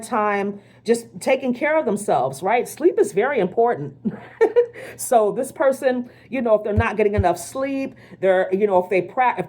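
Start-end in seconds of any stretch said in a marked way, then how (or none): 4.4-4.9 a short laugh, jumping high; a quick high giggle; high burst of laughter. Real, not acted. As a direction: laugh out at your own joke. Laughs at own remark.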